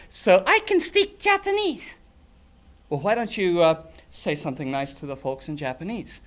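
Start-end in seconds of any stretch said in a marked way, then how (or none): 0.1-1.9 chirpy little dragon saying hi; silly puppet creature. Acted. Grork puppet boasting.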